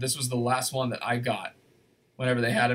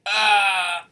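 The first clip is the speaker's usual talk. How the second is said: pained cry; 6 semitones higher, 5 dB louder than usual. Real, not acted. Squeezing into tight jacket.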